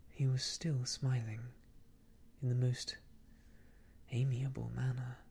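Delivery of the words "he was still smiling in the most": usual speaking voice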